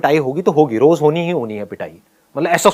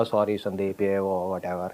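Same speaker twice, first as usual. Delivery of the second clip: a lightly voiced, much softer funny apology; funny teacher voice; 7 semitones lower, 11 dB quieter, slower than usual. PT teacher apologising to Sandeep, soft funny voice. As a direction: mumble the sorry like a sheepish teacher. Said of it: "उनकी आवाज़ थी बड़ी funny सी".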